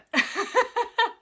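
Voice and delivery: loud, high-pitched laugh; real burst of laughter. Real, not acted. Vlogger laughing at having lost their place, amused.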